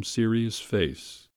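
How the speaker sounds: plain reading voice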